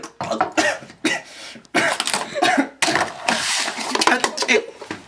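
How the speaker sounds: long choking cough; coughing fit. Pretend. Host choking on laundry soap, coughing hard. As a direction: hack and splutter at length.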